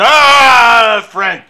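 loud, slurred ad-read; booming wine-commercial sigh; Orson Welles voice. Retold or imitated. Imitating Welles ad.

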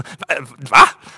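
stammered start into a loud "what?"; acted shock, suddenly loud; startled disbelief. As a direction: burst out with an astonished what. Nurse reacts in disbelief.

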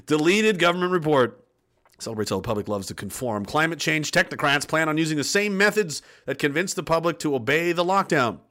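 ordinary delivery

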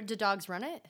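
usual speaking voice

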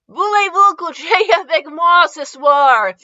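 slow, loud, high French phrase; halting French attempt. A narrator's reading. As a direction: announce it in laboured French. Character attempts his only French phrase.

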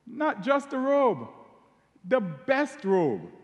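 "best" pushed up high and slow; emphasis on best. Shown as a demonstration. Preacher stressing the father's generosity to listeners, slow emphatic stress. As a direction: stretch "the best robe", hitting "best". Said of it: "And for emphasis".

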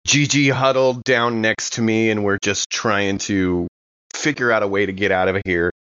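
ordinary talk

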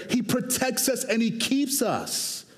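ordinary talk, nothing marked

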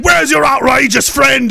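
hammed-up angry bouncer voice; mad demand. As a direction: bark it like a furious giant. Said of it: "He was all mad".

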